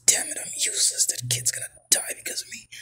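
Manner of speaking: whispered, acted self-reproach; hushed hero's despair, all whisper. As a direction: curse yourself under your breath. Hero despairs at his uselessness.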